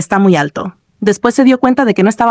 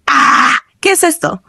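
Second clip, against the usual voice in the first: high-pitched startled yelp; sudden cry; 6 semitones higher, slower than usual. A narrator's reading. Gamer as the lights go out, sudden startled cry. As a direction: cry out high, without much volume. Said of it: "De pronto gritó".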